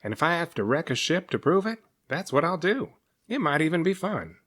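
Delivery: high, stubborn monster voice; huffy serpent. Acted. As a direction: prove you're tough. Cyrus huffy resolve.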